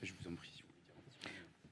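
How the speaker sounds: fast whispered aside; whispering. Real, not acted. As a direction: whisper it quickly off-mic. Host quietly invites guest to speak.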